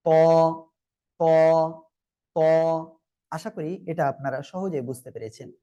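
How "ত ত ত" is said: ত made full and heavy, rounded "aw" vowel; thick heavy sound. Shown as a demonstration. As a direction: model a thick emphatic ত from the tooth roots. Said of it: "মোটা আওয়াজে উচ্চারণ হবে".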